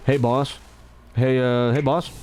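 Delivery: low, easy greeting. Shown as a demonstration. Greeting a buddy, low casual tone. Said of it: "I'm just used to low tone".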